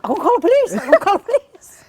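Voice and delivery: high, loud mock protest; acted high-pitched yelping; panicked echo. Retells her panicked echo behind friend.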